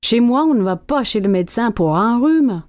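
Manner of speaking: ordinary talk, nothing marked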